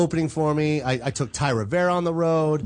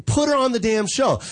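higher-pitched mock insistence; retold exasperated plea; 6 semitones higher than usual. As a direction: push it as an exasperated demand. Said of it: "I was like, Jamie".

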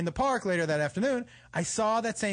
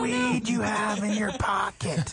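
mock warning; 2 semitones higher, slower than usual. Real, not acted. Teasing a cohost.

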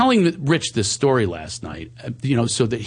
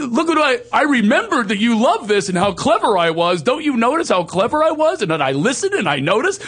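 high, loud eager bragging; needy mock boast; 12 semitones higher, 3 dB louder than usual. Mocking his approval-seeking self, high eager brag. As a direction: show off like a kid wanting praise.

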